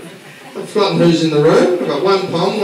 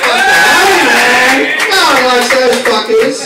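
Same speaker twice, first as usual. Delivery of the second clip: high, loud jeering call; loud mock cheer; 6 semitones higher, 6 dB louder, much slower than usual. Real, not acted. Comic rousing the crowd against the French, loud jeer. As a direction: whip the room up against the French.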